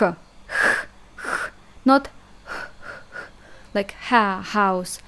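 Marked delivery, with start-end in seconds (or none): 0.0-3.3 h said as a rasping kh; Russian kh sound demo. Shown as a demonstration. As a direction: breathe out a harsh kh. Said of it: "is h".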